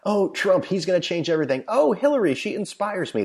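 high, gushing parody of a starry-eyed voter; sarcastic high voice of partisan fans; mock fanboy voice. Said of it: "These people that are so for one side".

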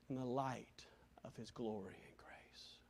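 whispered end of the hymn. Real, not acted. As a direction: let the hymn fade to a whisper. Hymn fades.

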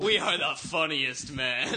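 slow, loud mock-grand proclamation; mock boast. Friend laughing at their own rude names, slow mock boast. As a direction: proclaim it slowly and loudly.

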